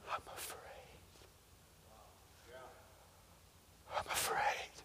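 barely audible frightened whisper; tiny, voiceless acted admission; afraid. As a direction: whisper the fear as a secret confession. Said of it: "being so afraid".

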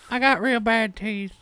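high, slow Southern character voice; Darlene. Acted. Darlene adding her own details, high Southern character. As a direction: drag it out in a high, silly twang. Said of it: "I'm Darlene".

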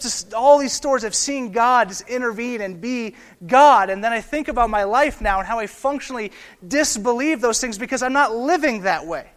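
loud, rising pulpit fervour, pushing each phrase harder; preaching at full force. Acted. Pastor urging his church, full-voiced preaching. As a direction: preach it like the room must hear.

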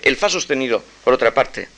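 usual speaking voice

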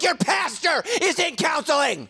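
high, loud laughing punchline; laughing retort. Retold or imitated. Pastor retelling his comeback, laughing and loud. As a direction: blurt it out laughing.

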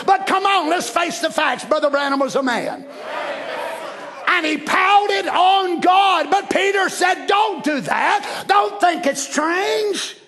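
high, drawn-out emphatic delivery; full-force preaching. Real, not acted. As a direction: exhort the congregation.

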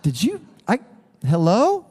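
high-pitched, hammed-up alarm; halting, then climbing into mock panic; nervous. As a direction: play a worried listener on the phone. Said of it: "I get nervous".

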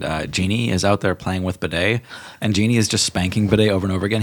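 ordinary talk

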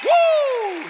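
high, loud preacher's whoop; excited woo. Real, not acted. Preacher whooping with excitement at the climax. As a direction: let out a joyful whoop.

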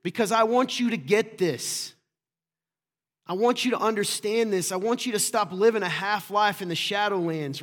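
urgent, insistent exhortation; passionate. Real, not acted. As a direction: press it home with real passion. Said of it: "I call it passion".

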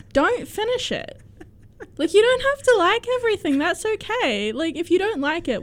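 high-pitched defensive insistence. Real, not acted. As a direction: argue back, voice climbing high. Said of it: "you're getting so defensive".

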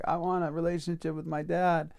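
high, lightly tearful retold plea; imitated crying. Man before two hundred people, mildly imitated tearful plea. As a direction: act out crying without raising the volume. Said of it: "he starts crying".

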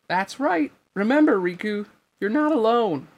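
the king interrupting from afar, high and loud; king's high voice. A narrator's reading. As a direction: call out high and firm. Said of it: "As he heard the king's voice".

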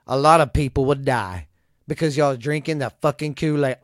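ordinary talk, nothing marked

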